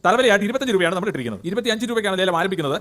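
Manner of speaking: auction calling, rising to the close; auctioneer. Acted. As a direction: call out the bids like an auctioneer. Calls the bids in an auction. (ലേലം വിളിക്കുന്ന ശൈലി)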